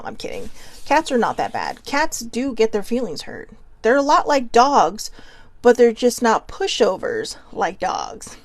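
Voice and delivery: ordinary delivery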